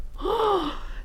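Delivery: high, soft gasp; distressed gasp. Acted. Mother goat discovering the empty house, grieving gasp. Goat's grief on finding kids gone.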